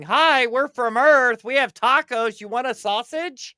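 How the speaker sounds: lightly mocking upbeat welcome message; chirpy probe greeting. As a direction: greet the cosmos like a friendly tourist. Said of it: "all these little satellites we sent out saying".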